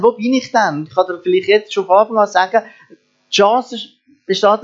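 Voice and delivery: ordinary talk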